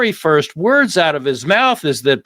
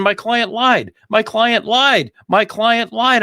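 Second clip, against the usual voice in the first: raised pitch, insistent repeated admission; mock defense attorney; 9 semitones higher than usual. Imitating the defense attorney's repeated admission to the jury. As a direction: hammer each repeat as the attorney. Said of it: "started right out by saying".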